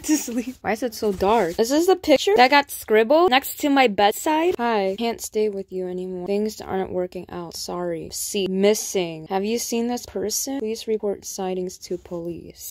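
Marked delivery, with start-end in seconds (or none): none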